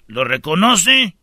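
ordinary talk

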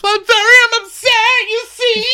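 very high, loud mock wail; mocking Kyle. Host mocking Kyle's fake upset at Kathy with a high, loud wail. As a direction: shriek it like a fake tantrum. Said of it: "And she goes".

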